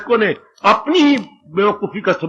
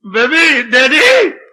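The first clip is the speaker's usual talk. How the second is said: put-on high, louder child's call; child calling Daddy; 4 semitones higher, 4 dB louder, much slower than usual. Child looks up and calls father, high child's call.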